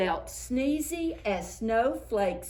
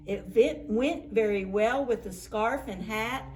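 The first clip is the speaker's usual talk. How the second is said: ordinary delivery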